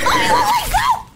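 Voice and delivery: high, slow terrified shriek; scared scream. Real, not acted. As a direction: shriek in real fright at the jump scare. Jump scare in the dark.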